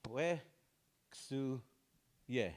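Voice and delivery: "we", "su", "ye" split with pauses; syllable by syllable. Shown as a demonstration. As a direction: spell out "we su ye" one slow syllable at a time. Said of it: "the sign language for remember".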